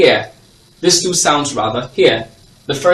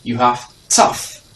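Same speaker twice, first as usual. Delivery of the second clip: ordinary talk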